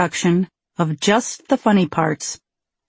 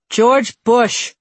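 higher, punchy answer; loud, instant reply; 4 semitones higher, 3 dB louder than usual. A narrator's reading. Patient answers hospital kitchen about dislikes, loud and unhesitating. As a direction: fire it back without hesitation. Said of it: "he replied loudly and without hesitation".

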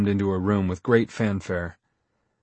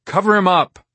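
higher, louder barked order; 10 semitones higher, 8 dB louder than usual. Acted. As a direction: snap it out fast. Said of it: "Mister Spencer barked out".

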